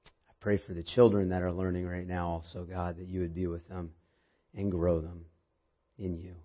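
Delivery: soft, hushed prayer voice. Acted. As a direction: pray quietly and reverently. Opening prayer before sermon.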